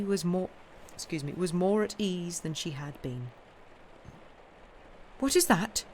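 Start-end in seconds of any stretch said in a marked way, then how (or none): 5.2-5.8 higher startled question; startled cry. Acted. Lucy startled by a noise outside, higher startled cry. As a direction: jump at a sudden sound. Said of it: "she cried all at once".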